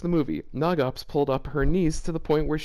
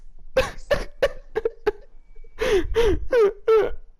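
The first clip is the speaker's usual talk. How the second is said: shrieking laugh. Real, not acted. Reader cracks up at line.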